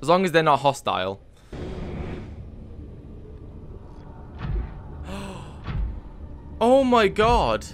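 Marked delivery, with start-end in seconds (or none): 1.5-6.5 a long, breathy gasp of shock. Real, not acted. Player sees the Sea Emperor collapse, sharp gasp. 6.6-7.7 drawn out slowly, higher and louder; stunned. Real, not acted. Player watching a dying Sea Emperor, slow stunned disbelief. Reacting to the creature's death.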